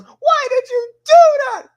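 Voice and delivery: mock-outraged shout, stretched out; outraged Cortana. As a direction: shriek it in hammed-up disbelief. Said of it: "And Cortana goes".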